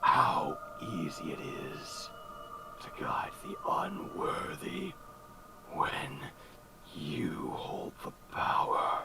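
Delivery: whispered phantom voice with long pauses; sinister spectre, tempting. Acted. Spectre offering power over the unworthy, sinister tempting whisper. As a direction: whisper temptingly, letting each phrase hang.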